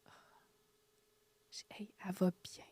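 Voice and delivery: chuchoté, doux et rassurant; murmure réconfortant. Retold or imitated. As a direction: console tout bas. Réconforte l'élève en deuil. (murmure doux qui rassure)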